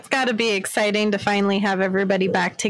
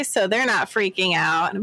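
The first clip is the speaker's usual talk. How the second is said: usual speaking voice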